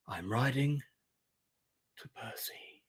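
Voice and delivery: sexy hero voice, hushed to a whisper; soft, halting, sultry hero; Lone Wolf. Acted. Hero tells a stranger where he rides, in a sexy hushed voice. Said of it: "my sexy lone wolf voice".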